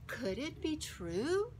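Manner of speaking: usual speaking voice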